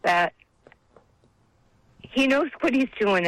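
holding back tears while testifying; getting emotional. Real, not acted. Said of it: "I'm sorry to get emotional".

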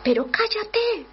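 high, slow exasperated hush; mock annoyed apostles. Apostles telling the shouting beggar to hush, mock exasperated. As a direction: shush the beggar in mock irritation. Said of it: "le decían".

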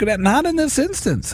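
high, slow mock retort. Driver answering the jaywalker who yelled, retorting. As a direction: argue back with the pitch pushed right up.

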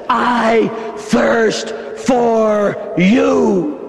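very slow, stretched I thirst for you; Jesus pleading from the cross, very slow; drawn-out plea. Acted. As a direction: draw every word out, pleading.

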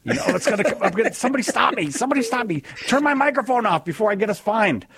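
high-pitched, frantic comic plea; Eddie Murphy bit. Acted. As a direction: beg to be stopped, pitched high and urgent. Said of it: "now I've got Eddie Murphy".

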